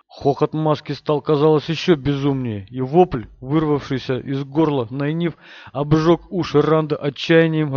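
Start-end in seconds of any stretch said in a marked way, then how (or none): none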